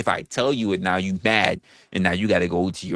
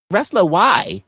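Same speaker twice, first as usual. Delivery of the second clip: higher, lightly voiced woman asking; imagined woman's reply; 9 semitones higher, 4 dB louder, slower than usual. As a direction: lightly mimic her puzzled question, pitched up.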